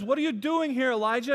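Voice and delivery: loud, high, demanding question; voice of God. Acted. Preacher voicing God questioning Elijah, loud and high. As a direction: call him out for hiding. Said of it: "God says".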